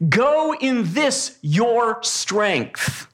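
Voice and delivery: very slow, weighty words from God; measured scripture quote; slow solemn quote. Acted. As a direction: lay each word down slowly. Quoting God to Gideon.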